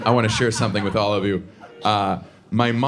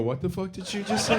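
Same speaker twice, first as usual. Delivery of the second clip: higher, softer mock outrage; imitating the attendants; 7 semitones higher, 6 dB quieter than usual. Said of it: "stopped what they were doing and go".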